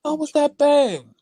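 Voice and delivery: high, drawn-out startled question; retold alarm. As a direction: replay the scare, slow and high. Re-enacts startled reaction.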